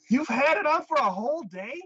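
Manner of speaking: high, loud disbelief; shocked question. Retold or imitated. The girl asking her boss in shock, high and loud.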